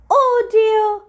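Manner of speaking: high, loud fairy voice crying oh dear; fairy Incissor worried. Acted. Fairy Incissor frets over brittle teeth, high-voiced. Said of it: "said Incissor".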